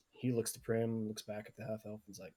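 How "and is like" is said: usual speaking voice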